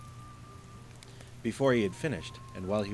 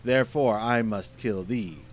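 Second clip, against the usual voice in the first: menacing genie; 3 semitones higher, 7 dB louder than usual. Acted. Genie pronounces sentence.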